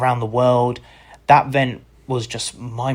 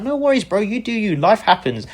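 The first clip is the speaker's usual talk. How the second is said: breezy reply; 11 semitones higher than usual. Retold or imitated. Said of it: "I'm like".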